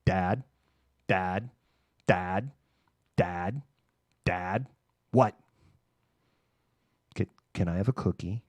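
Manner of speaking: lightly voiced nagging child; a hint of a pestering three-year-old; whiny toddler cat. Cat pestering dad for a cookie, lightly voiced whiny child. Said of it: "acting like a three-year-old".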